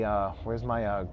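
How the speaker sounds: ordinary talk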